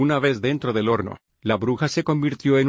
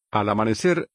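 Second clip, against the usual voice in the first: plain reading voice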